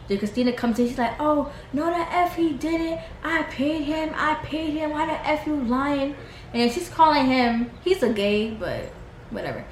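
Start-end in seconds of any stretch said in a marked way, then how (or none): 1.2-6.2 mock going off. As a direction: imitate an outraged denial. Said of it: "she's just going off at everybody".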